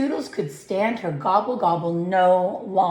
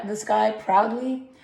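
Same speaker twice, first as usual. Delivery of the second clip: plain reading voice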